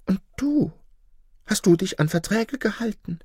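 high-pitched retorting fox; challenging fox. A narrator's reading. Fox questioning the wolf's own loyalty, high and challenging.

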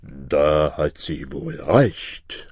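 low, very slow monster voice; deep, ponderous bird monster; self-satisfied griffin. Acted. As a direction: stretch it out in a deep creature voice. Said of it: "sagte der Vogel Greif".

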